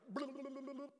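high, rapid run-on babble; mock uncontrolled mouthing-off; mock babbling. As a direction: spill it out on one high note. Mimics a negative rant spilling out.

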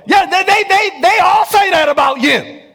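high, loud hammed-up quarrel; mock marital shouting match. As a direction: shout back and forth like a fighting couple. Quarrel acted both sides.

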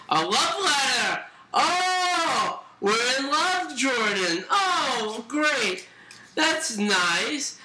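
high, swooping sarcastic gushing; mocking teacher. Friend voicing teacher finding a love letter, high mocking gush. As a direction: gush with drawn-out fake delight. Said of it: "She's like".

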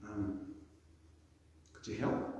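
timid, whispered, hesitant ask; hedging. Retold or imitated. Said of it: "would have hedged and gone".

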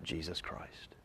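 hushed whisper of the name; breathy whispered Jesus Christ. Real, not acted. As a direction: drop to a reverent whisper. Whispered climax of sermon point.

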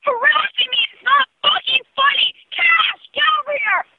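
high, shrill screaming rant; furious screaming. Real, not acted. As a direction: scream it, near tears. Furious caller screaming about calls.

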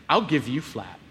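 low, soft mock menace; defiant retort. Angry boy answers dad's order, low and defiant.

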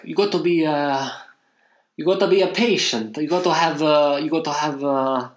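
put-on hesitant broken English in an Italian accent. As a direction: play an Italian searching for English words. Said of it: "he's very Italian".

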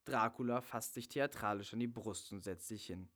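ordinary talk

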